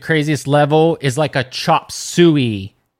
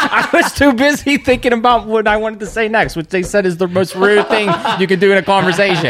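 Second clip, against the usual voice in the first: raised pitch, words bubbling through laughter; laughing; 5 semitones higher, 3 dB louder than usual. Real, not acted. Co-host admitting not listening, laughing at self. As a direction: confess it while cracking up. Laughing at own narcissist admission.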